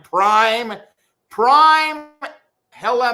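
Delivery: drawn-out, rising loud "Prime"; hyped-up call. Real, not acted. Streamer hyping Prime to the audience, calling out loudly.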